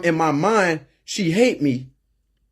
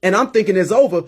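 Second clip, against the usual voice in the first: ordinary delivery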